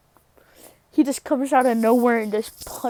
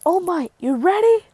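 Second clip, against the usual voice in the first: high, eager call; raised-pitch readiness check; acted rallying question; 7 semitones higher, 3 dB louder than usual. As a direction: call to a partner with rising pitch. Deku calls to All Might.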